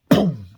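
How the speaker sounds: loud, high mouth boom; sudden explosion noise; explosion sound. Retold or imitated. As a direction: shout out a big blast. Explosion in the story.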